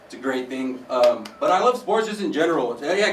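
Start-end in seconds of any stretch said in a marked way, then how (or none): none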